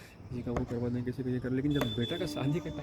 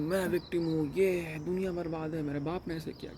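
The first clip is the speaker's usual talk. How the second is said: mock victim complaint; 3 semitones higher than usual. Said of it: "वो भी यही दिखा रही है".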